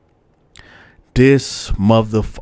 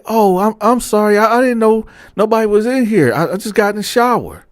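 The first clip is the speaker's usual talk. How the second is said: lightly voiced flustered old man, pitched higher; flustered cellmate; 11 semitones higher than usual. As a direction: play him caught out and apologizing, higher up.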